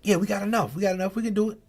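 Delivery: a light touch of cheerful comfort to his daughter; reassuring dad. Retold or imitated. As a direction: sound sure so she won't worry. Dad reassuring daughter over money.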